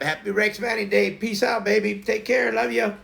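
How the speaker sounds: high, bright, bouncy farewell; cheery sign-off. Acted. Signing off to viewers in a sing-song farewell. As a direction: sing out the goodbye.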